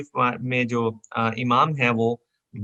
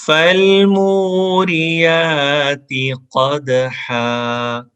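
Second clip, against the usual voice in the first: slow melodic chant, a bit higher; Quranic recitation; 4 semitones higher, 5 dB louder, much slower than usual. Shown as a demonstration. Teacher chanting the verse for students to repeat. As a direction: recite each verse slowly for repetition.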